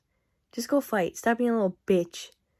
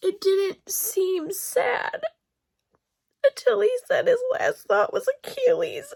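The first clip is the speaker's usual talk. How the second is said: high, tearful voice cracking while talking; crying; 11 semitones higher than usual. Real, not acted. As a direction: talk through real tears. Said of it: "me crying".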